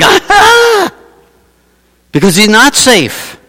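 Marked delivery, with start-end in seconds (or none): 0.0-0.9 very high, loud cry of dread; hammed-up cry of terror; terrified shriek. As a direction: shriek like a prophet undone. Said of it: "Why do you think Isaiah's going".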